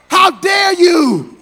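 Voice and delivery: slow, emphatic indignant question; indignant rebuke. Acted. Pastor rebuking those who judge people at the altar, slow and indignant. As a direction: scold them slowly, word by word.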